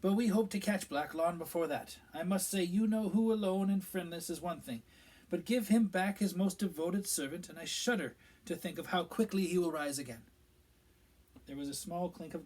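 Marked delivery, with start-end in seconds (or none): 0.0-10.2 a higher, louder official's voice; Minister Fudge. A narrator's reading. Minister explaining events to the pub gathering, self-important. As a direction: voice the pompous minister telling the tale.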